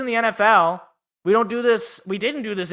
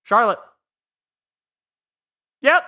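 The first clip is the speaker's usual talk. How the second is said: ordinary talk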